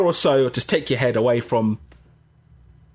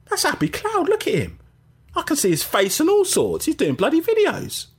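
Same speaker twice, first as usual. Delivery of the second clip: excited surprise; 13 semitones higher than usual. Retold or imitated. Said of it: "I thought".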